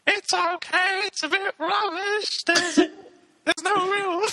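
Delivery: very high, slow robotic falsetto; sing-song auto-tuned robot voice. Pretend. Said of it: "Mister Gibbs asked".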